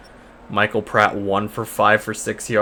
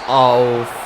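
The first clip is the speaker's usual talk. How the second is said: "off" drawn out long and loud; weird "off"; 3 dB louder than usual. Real, not acted. Gamer calling a pass that is off target, oddly stretched. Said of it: "I said off so weird".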